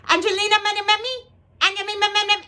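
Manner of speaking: high-pitched mock Chinese-accented announcer; imitated Chinese accent. Mother mimics the airport page she overheard, high mock Chinese accent. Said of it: "the woman sounded Chinese".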